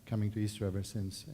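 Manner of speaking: ordinary talk, nothing marked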